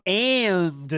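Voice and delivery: "And" stretched as ay-unn-duh; three-syllable and. Retold or imitated. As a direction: draw "And" out with an extra final syllable. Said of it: "He makes it three syllables".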